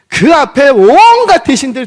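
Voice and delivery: high, loud exclamation; forceful preaching. Real, not acted. Preacher describing the crowd of ministers, loudly emphatic. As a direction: swell hard on 온갖.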